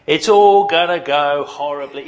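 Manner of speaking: a hammed-up prophet of disaster; lightly voiced doom-monger; gloomy Eeyore. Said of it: "Thomas just is Eeyore".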